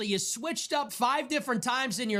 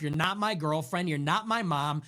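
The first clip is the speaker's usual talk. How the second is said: usual speaking voice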